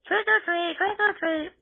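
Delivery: high-pitched mock child voice; squeaky imitation of children; trick-or-treating kids. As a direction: chant like kids at the door.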